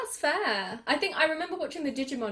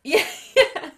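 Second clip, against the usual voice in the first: laughing yeah; 8 semitones higher, 8 dB louder than usual. Real, not acted. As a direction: laugh through the yes. Laughs while agreeing.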